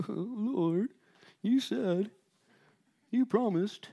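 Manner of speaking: high, halting whimper; mock whimpering. Preacher acts Joshua on the ground pleading, mock whimpering. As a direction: plead pitifully, in broken little phrases.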